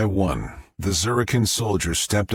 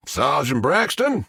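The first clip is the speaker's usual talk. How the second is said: yelled; 7 semitones higher than usual. A narrator's reading. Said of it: "and yelled".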